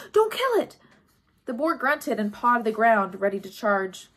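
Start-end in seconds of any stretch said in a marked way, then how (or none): 0.1-0.8 much higher, loud urgent yell. A narrator's reading. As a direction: shout to stop her. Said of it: "Grover yelled".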